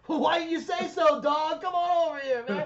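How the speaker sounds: high, hammed-up friendly holler; exuberant welcome. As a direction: imitate a big star suddenly welcoming you like a pal. Suddenly friendly star beckons fan over.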